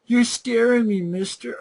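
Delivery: mock the kid's pleading in a squeaky voice; sarcastic replay of the kid's whimper; mock scared kid. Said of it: "you stop and go".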